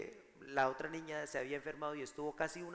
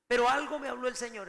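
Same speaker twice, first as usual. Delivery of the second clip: loud, high emphatic preaching; loud, lifted preacher's cry; preaching burst; 7 semitones higher, 9 dB louder than usual. Real, not acted. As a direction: proclaim it to the congregation. Preacher's sudden emphatic outburst.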